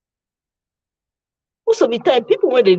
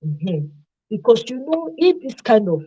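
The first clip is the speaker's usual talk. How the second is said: normal talking voice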